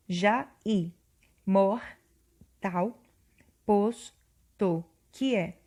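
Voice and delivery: "Já i", "mor", "tal", "posto", "que é" each said apart with pauses; syllable by syllable. Shown as a demonstration. Teacher showing students how the vowels join, syllables spoken apart. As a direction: tap out each poetic syllable. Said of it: "nós vamos juntar".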